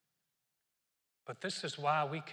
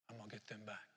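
muttered revenge thought; 6 semitones lower, 13 dB quieter than usual. Retold or imitated.

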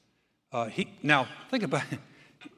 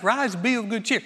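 higher, louder mock-eager encouragement; mock eager crowd; 6 semitones higher, 4 dB louder than usual. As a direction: ham up the crowd's sudden friendliness. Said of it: "he's like".

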